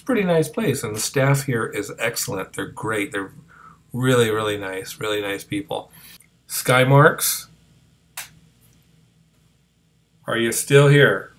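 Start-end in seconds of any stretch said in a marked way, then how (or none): 6.5-11.3 calling out. Real, not acted. Calling out to a spirit.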